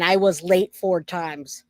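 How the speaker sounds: normal talking voice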